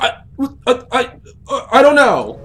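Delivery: high, loud frightened stammer; panicked stammer. Retold or imitated. Craig stammers his answer in high frightened panic. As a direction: stumble over it in fear. Craig unsure why heart races.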